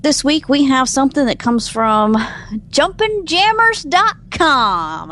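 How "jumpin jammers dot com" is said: drawn out. Shown as a demonstration. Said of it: "I should say that in a southern accent".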